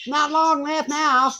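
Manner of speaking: put-on high, slow baby voice. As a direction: put on a baby's voice, slowly. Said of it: "And the baby goes".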